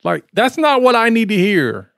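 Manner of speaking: usual speaking voice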